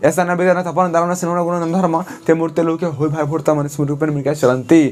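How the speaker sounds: rattled off fast recitation. Shown as a demonstration. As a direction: rush through the verse quickly. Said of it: "इसको हम पहले fast बोल रहे हैं".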